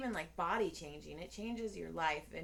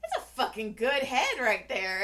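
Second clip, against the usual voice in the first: loud, higher, emphatic compliment; calling out; 4 semitones higher, 7 dB louder than usual. Acted. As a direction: blurt it out across the room. Calls it out as he walks in.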